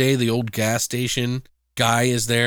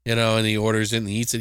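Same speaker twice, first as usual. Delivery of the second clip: usual speaking voice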